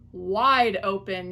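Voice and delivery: loud, slow shout; yell. A narrator's reading. Wife yelling at the fox to open wide. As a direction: bellow it out. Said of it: "yelled his wife".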